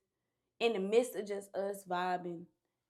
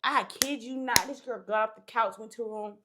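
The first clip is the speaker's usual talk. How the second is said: ordinary delivery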